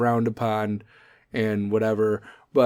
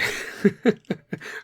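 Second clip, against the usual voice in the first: laugh; 8 semitones higher than usual. Real, not acted. Laughs at own joke.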